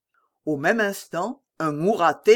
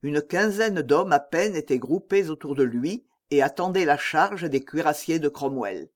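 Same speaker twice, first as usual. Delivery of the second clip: usual speaking voice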